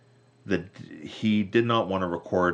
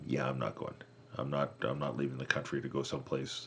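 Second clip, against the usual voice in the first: lower, softer dismissive refusal; mock refusal; 4 semitones lower, 6 dB quieter than usual. Drummer calls up to back out, lightly voiced refusal. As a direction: turn them down low and offhand.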